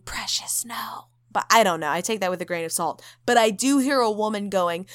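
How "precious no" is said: faint ghostly whisper. Retold or imitated. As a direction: breathe it like a ghost. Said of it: "a woman saying".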